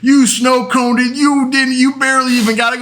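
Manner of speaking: high-pitched, indignant mock accusation; accusing retort. Brother belittling the catch, high indignant retort. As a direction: imitate a brother arguing back, pitch way up.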